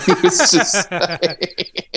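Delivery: breaking into real high laughter; real laughter overtaking the line; laughing. Real, not acted. Laughing at the tour memory.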